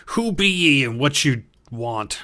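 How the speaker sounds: suspicious guard behind the door; gruff gatekeeper challenging strangers. Acted.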